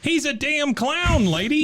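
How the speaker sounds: high, slow hammed-up tough-guy line; hammed-up carnival boss; mock tough guy. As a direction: growl it like a cartoon heavy. Mock tough guy addressing lady.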